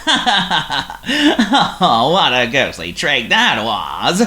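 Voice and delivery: pompous, snorting, gloating voice; snorty pompous voice. A narrator's reading. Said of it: "in a snorty, pompous voice".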